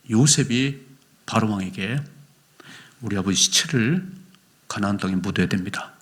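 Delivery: normal talking voice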